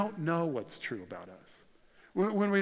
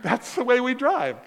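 indignant protest; 9 semitones higher, 9 dB louder than usual. Retold or imitated. Arguing with a traffic cop.

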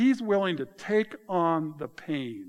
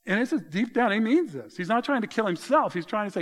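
ordinary talk, nothing marked

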